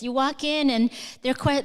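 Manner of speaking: ordinary delivery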